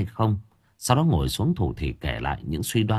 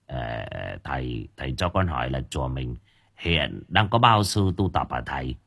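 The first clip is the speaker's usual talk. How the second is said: hesitant; 6 semitones lower, 3 dB quieter than usual. A narrator's reading. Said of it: "sau một hồi ngập ngừng".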